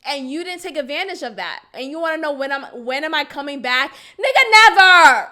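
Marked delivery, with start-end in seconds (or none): 3.9-5.3 much higher, loud never; emphatic refusal. Real, not acted. Answering him about coming back, loud refusal. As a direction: throw out never at full force.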